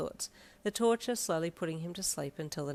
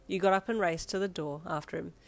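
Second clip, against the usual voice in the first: usual speaking voice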